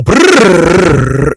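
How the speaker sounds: a long, slow lip-buzz brrr; rumble strip brrr. Retold or imitated. Imitating tyres crossing the lane bumps. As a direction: drag out the buzzing rumble. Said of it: "they go".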